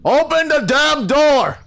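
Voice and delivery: high, loud drawn-out order; angry shout. Acted. Chief outside demanding entry, angry shout. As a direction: bellow a slow, high command.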